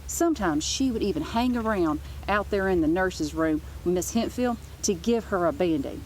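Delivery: ordinary delivery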